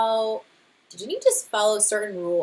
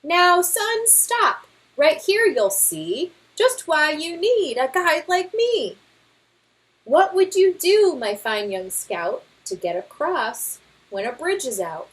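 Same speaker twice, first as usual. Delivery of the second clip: louder, blustering know-it-all father bear; boastful Papa Bear; 8 semitones higher, 5 dB louder than usual. Acted. Father bear brushing off the scouts' guidebook, boastful. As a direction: scoff at the book with bravado.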